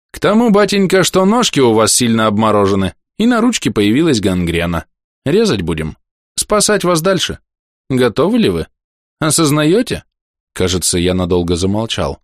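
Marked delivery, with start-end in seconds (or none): 0.1-10.0 lightly voiced gruff doctor, higher; a hint of a blunt stern physician. Доктор объявляет ампутацию. (лёгкий голос хмурого доктора)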